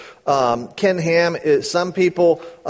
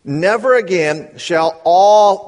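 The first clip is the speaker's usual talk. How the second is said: slow, louder deliberate reading; 4 semitones higher, 6 dB louder, much slower than usual. Shown as a demonstration. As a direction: read each word slowly and with weight. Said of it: "now you gotta read this slowly".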